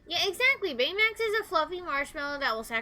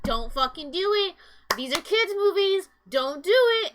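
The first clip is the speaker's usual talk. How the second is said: louder, higher imitated plea at the screen; retold plea to the movie; 6 semitones higher, 7 dB louder than usual. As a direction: beg the movie not to do it, half-yelling. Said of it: "I was like".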